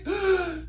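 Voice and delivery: sharp, high, thrilled gasp; excited gasp. Retold or imitated. Fan hearing from her celebrity crush, excited gasp. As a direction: gasp like you can't believe it. Reacting to celebrity birthday wish.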